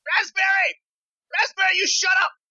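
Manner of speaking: high-pitched mock scolding yell; acted yelling. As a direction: screech at the baby as the character. Character yells at crying baby.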